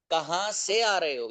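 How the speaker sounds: slow, drawn-out question for learners; slow repeat. Shown as a demonstration. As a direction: stretch the question out so students follow.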